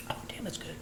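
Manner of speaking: soft whisper of delight. Real, not acted. Cook tastes the dressing and whispers his delight. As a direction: breathe it after the taste.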